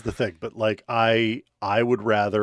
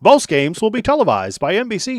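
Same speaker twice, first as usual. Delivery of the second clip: much higher, louder vintage radio announcer; old-timey announcer; 10 semitones higher, 6 dB louder than usual. Pretend. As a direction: read it like a 1930s newsreel. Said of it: "reading this like it's fucking old-timey baseball".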